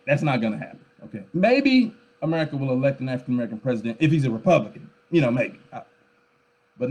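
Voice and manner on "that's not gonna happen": ordinary talk, nothing marked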